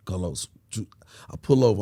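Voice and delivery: ordinary talk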